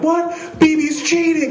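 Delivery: put-on high, outraged little-kid voice; indignant kid's cry. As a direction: squeal it like a six-year-old who's been wronged. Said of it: "you could see Andrew like".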